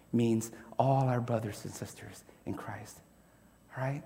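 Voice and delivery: soft unvoiced whisper. Real, not acted. Preacher whispering to the congregation who "one another" means. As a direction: lean in and whisper it to them.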